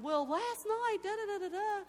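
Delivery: high, loud, rushing to tell the story; bursting out excited. Retold or imitated. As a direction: blurt it out, too excited to wait. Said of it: "didn't even care I was cutting her off".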